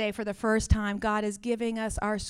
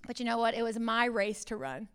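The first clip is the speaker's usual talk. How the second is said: ordinary talk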